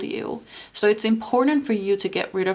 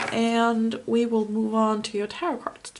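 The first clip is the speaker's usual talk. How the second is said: ordinary delivery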